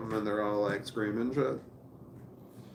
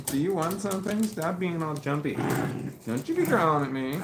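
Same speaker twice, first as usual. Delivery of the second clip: higher, louder scolding of the puppy; high talk to the dog; 7 semitones higher, 5 dB louder than usual. Real, not acted. Owner playfully scolding his growling puppy, higher and louder. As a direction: playfully tell the dog off, pitched up.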